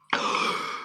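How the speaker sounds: sharp breathless gasp; a startled pirate's gasp; frightened gasp. Acted. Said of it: "gasped Hook".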